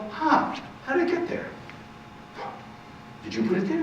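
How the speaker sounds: high, hushed feigned astonishment; mock surprise. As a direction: act amazed to draw the child in.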